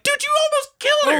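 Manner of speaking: exasperated high yell at the screen; outraged yell. Retold or imitated. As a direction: shout at the villain in disbelief. Yelling at the Phantom onscreen.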